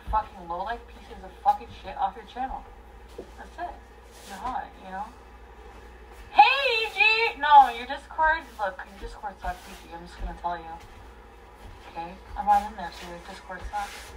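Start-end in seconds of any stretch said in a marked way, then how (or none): 6.3-8.4 high, slow, loud call. Real, not acted. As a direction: stretch it out like yelling across a room. Calling out a streamer.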